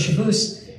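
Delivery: high rising "Shabbos" as shuh-BOOS; mock Piers saying "Shabbos" as shuh-BOOS; mispronounced name. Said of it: "They literally all mispronounce it".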